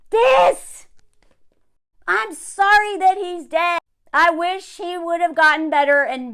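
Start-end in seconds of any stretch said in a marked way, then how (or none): 0.1-0.9 high, cracking voice on one word; a creator gets choked up about him, voice cracking; voice breaking. Real, not acted. Real voice crack, near tears.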